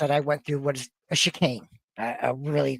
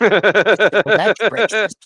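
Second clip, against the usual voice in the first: laughing; 6 semitones higher, 8 dB louder, much slower than usual. Real, not acted. Joking reply to boss story.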